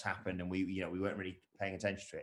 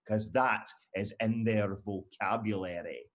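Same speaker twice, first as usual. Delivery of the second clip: ordinary talk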